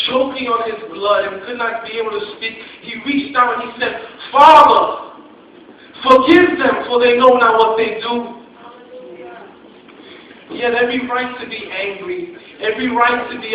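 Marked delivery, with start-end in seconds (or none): 4.3-8.4 loud anguished cry, pitched higher. Acted. Jesus on the cross crying out to the Father, loud and anguished. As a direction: cry it out to heaven with everything left.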